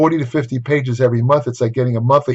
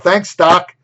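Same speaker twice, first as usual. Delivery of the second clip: a light, cheery, higher and louder thanks tossed over the shoulder; breezy mock thanks; 6 semitones higher, 5 dB louder than usual. Patient walking out on his doctor, breezy mock thanks. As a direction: play the patient who walks out without paying.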